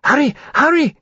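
very high, urgent dragon cry; impatient urging. A narrator's reading. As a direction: hurry them along in a high voice. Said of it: "circling impatiently".

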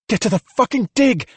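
high-pitched shouted command; urgent shout. A narrator's reading. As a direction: shout the order to a crowd. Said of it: "Juliet shouted".

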